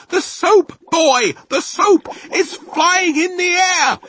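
high, loud, slow astonishment; drawn-out frantic exclamation; amazed shout. A narrator's reading. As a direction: wail it out in disbelief. Dad amazed by floating soap, shouting.

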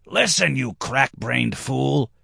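high, slow exasperated call; strained high, no real volume, dragging the insult out; mock shout. A narrator's reading. Redhead shouts at his foolish friend, exasperated. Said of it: "he shouted at his friend".

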